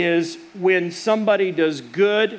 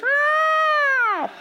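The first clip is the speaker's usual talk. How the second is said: sudden high squawk like a bird; imitated bird cry, high and sharp. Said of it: "this bird goes".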